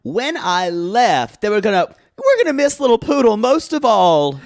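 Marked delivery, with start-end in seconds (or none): 2.2-4.4 higher, mock-wistful farewell; playful higher-voiced lament; crew's fond farewell. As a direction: lightly act a heartfelt goodbye, pitched up. Imagined crew missing the child.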